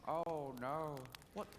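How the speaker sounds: mock horror, slow and drawn out with a laugh; hammed-up shock at the mirror; Elephant Man horrified. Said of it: "he'd go".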